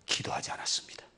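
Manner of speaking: unvoiced, hushed answer; preacher answers his own question in a whisper. Real, not acted. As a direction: drop to a breath on the reply.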